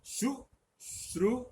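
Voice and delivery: high, slow शुश्रूषा; careful demo. Shown as a demonstration. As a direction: stretch out शुश्रूषा, palatal श then retroflex ष. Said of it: "ये मूर्धन्य है".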